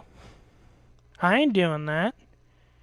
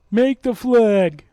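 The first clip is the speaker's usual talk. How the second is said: slow, loud mocking demand; 2 semitones higher, 6 dB louder, slower than usual. Imitating the friend demanding flag instructions, slow and loud. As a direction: bark out his request. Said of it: "then he goes".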